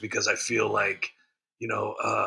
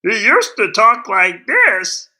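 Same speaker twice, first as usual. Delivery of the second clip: high, slow, loud put-on politician voice; Adam Schiff voice; 11 semitones higher, 12 dB louder, slower than usual. Showing viewers how Schiff used to sound, high and slow. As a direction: pitch way up and stretch it out like a pompous senator. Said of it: "I do the, the Schiff voice like this".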